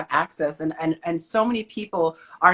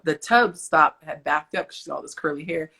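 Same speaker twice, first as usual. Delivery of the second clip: usual speaking voice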